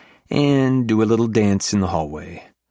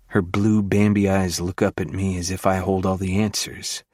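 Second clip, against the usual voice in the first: usual speaking voice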